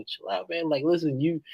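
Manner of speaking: ordinary delivery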